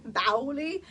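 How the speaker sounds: Bauli said BOW-lee with a light Turkish accent and a rising end; Turkish accent imitation. Shown as a demonstration. Wife repeats Bauli in the CEO's Turkish accent, amused. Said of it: "the way he says it".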